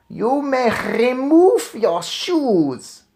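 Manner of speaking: put-on high, very slow, loud barked order with a foreign accent; Grand High Witch barking. A narrator's reading. Witch leader barking orders at her witches. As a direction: bark it like a rasping hag commander. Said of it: "barked the Grand High Witch".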